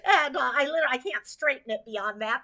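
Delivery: ordinary talk, nothing marked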